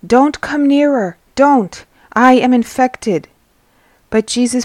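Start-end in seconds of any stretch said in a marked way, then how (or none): 0.1-3.3 high, loud warning; crying out. A narrator's reading. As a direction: cry out to keep someone away. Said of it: "he cries out".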